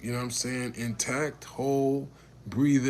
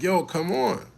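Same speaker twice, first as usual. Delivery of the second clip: urgent call; 7 semitones higher, 6 dB louder than usual. Retold or imitated. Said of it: "I'm telling her".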